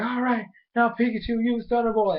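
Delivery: high, decisive hero voice; trainer's battle command. Retold or imitated. Ash orders the finishing attack, high imitated command. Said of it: "he's like".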